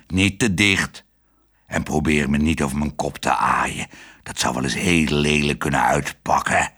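low, dutiful dog voice, slowing at the end; a proud dog doing his duty; stern guard dog. A narrator's reading. Dog Herman accusing the father, low and stern.

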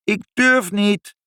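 very high, slow, drawn-out call; calling back. A narrator's reading. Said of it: "riep moeder terug".

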